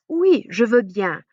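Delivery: slow, clear "Oui, je veux bien"; careful French. Shown as a demonstration. Teacher models accepting in French, slowly. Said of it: "another thing you could say is".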